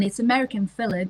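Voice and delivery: normal talking voice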